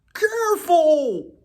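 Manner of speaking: high, slow, stretched dog's cry. Acted. Teacher reading to class, Molly the collie cries out high. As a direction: call out like a startled collie. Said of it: "Molly cried".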